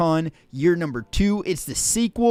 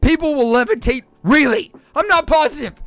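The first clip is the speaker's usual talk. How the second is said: playful hype; 6 semitones higher, 6 dB louder than usual. Real, not acted. Hyping the event.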